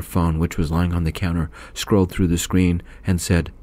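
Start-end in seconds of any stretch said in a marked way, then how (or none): none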